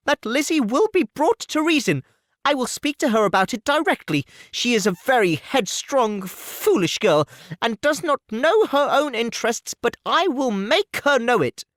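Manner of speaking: an anxious mother promising to bring her daughter round; Mrs Bennet, fussing. Acted. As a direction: gush reassurance at the suitor.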